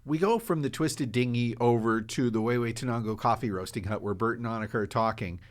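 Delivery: ordinary talk, nothing marked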